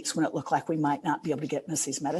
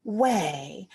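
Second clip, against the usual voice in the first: higher and drawn out very slowly; reliving the moment she saw the donation, higher and very slow; stunned disbelief; 7 semitones higher, much slower than usual. Retold or imitated. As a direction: stretch each word in amazed disbelief. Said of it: "And I was like".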